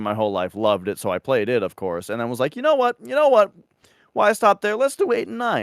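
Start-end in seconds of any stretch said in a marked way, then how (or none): 2.6-5.6 high-pitched, eager self-quote; rallying yourself to keep going; excited decision. Retold or imitated. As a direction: talk yourself into it, voice jumping up. Acting out own eager decision.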